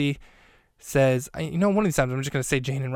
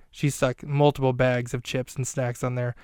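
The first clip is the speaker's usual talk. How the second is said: ordinary talk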